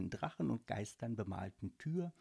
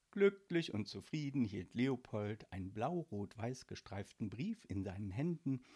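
ordinary delivery

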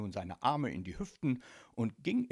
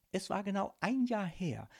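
usual speaking voice

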